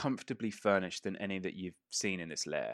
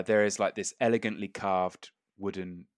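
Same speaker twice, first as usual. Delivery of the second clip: ordinary talk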